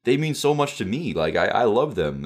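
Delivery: high gushing fan excitement; excited. Retold or imitated. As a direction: gush high like a thrilled fan. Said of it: "I got me so excited".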